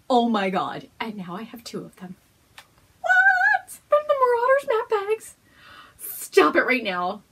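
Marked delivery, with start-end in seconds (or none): 3.0-5.3 very high, loud squeal; shocked squeal. Real, not acted. Discovering a second Harry Potter bag, shocked squeal. Shock at getting two bags. 6.0-7.2 slow, loud mock outrage; delighted protest. Real, not acted. Reacting to too many gifts, slow loud mock outrage. Overwhelmed by the gift.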